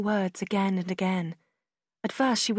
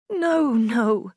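crying out; 3 semitones higher, 6 dB louder than usual. A narrator's reading. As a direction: cut in with a pained outcry. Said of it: "Marianne cried".

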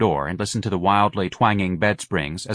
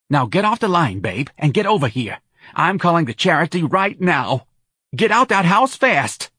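Santa, urgent; 9 semitones higher, 4 dB louder than usual. A narrator's reading. Friend ordering the mother out of the house, urgent. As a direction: build to a loud order.

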